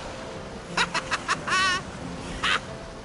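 high breathy gloating cackle; triumphant kobold cackle. Acted. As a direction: cackle at him gleefully.

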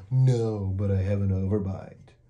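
a gentle monster proud of his panting; mouth-breathing monster. A narrator's reading. Herbert shows his breathing.

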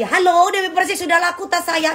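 high, sing-song imitation of a pushy seller calling; playful impression of a nagging consignor on the phone; mock phone caller. Repeats the mimicked phone call.

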